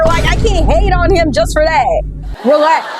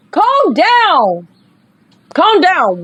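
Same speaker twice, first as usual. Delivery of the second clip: drawn-out calm down; 5 semitones higher, much slower than usual. Real, not acted. Telling game character to relax.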